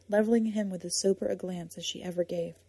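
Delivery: ordinary delivery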